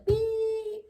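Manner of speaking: high buzzer beep sound. Shown as a demonstration. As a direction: mimic a game-show buzzer. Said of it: "I need a buzzer".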